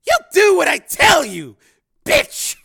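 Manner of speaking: high, loud, snarling order; furious snarl. Acted. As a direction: snarl it at full force to dominate her. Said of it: "he snarled".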